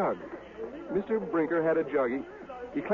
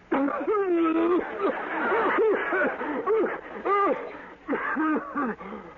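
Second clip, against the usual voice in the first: sputtering cough after a strong swig; spluttering cough as the cider burns; coughing fit; 12 semitones higher than usual. Acted. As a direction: choke on the drink, cough hard.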